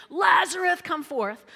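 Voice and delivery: loud, high summons; commanding call. Acted. Jesus calling Lazarus out of the grave. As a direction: call him out of the tomb.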